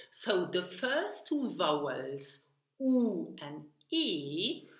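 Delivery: ordinary talk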